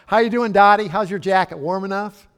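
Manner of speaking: calling out brightly and loudly across the room; a hearty, booming hello to a friend; loud cheery greeting. Retold or imitated. Pastor loudly greeting Dottie at church, hearty.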